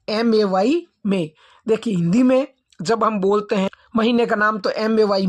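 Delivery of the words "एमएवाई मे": ordinary talk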